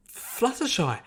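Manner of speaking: high, loud alarmed call; shocked cry. A narrator's reading. Friends crying after Fluttershy in shock. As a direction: call out fearing the worst. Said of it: "the others cried in shock".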